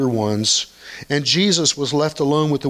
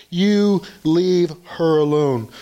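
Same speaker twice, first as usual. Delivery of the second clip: slow, higher-pitched, measured rebuke; firm rebuke; 4 semitones higher, slower than usual. Acted. Jesus standing up for a scolded woman, firm rebuke. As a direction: defend her, spacing out each word.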